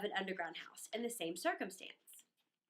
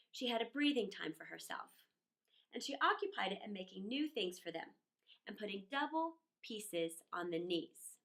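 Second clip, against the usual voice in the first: ordinary delivery, nothing marked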